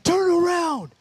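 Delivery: high, loud, strange demonic shout; loud, high demon voices shouting turn around; demons yelling. Retold or imitated. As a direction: bark it at him like a demon horde. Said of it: "they're like".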